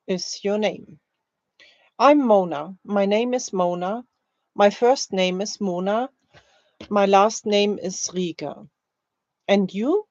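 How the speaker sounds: ordinary delivery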